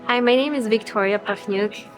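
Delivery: ordinary talk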